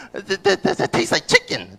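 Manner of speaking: high, stuttering mock chomper; imitated eater. Comic playing someone eating dog, high stuttering verdict. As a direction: stammer it out in a squeaky pitch.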